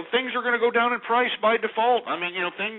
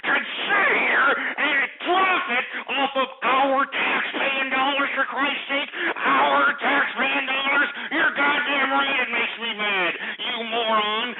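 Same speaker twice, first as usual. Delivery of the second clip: furious rant; 7 semitones higher, slower than usual. Acted. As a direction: spit each word out at the moocher. Host rants angrily at caller.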